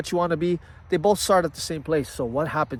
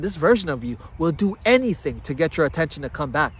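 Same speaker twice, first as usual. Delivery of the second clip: normal talking voice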